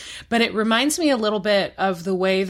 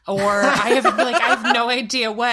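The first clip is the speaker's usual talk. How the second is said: laughing; 3 semitones higher, 7 dB louder than usual. Real, not acted. Laughing at own joke.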